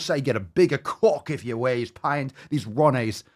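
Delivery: broad put-on Aussie twang, mocking; dumb English-Australian accent. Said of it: "you have an English or Australian accent".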